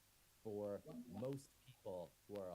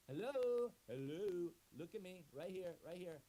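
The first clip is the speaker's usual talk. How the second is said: higher, louder hello to grab attention; loud in-your-face calling; 7 semitones higher, 6 dB louder, slower than usual. Acted. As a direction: shout it right in their face. Gets right in your face.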